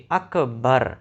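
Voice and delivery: اکبر said as akbaar, a long alif after the b; wrong long a in akbar. Shown as a demonstration. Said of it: "یعنی با کے بعد الف پڑھتے ہیں". (اکبر با کے بعد الف لگا کر غلط تلفظ)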